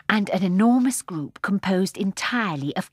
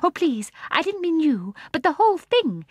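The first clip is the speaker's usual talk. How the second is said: eager explaining; 10 semitones higher, 4 dB louder than usual. A narrator's reading. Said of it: "explained Jane".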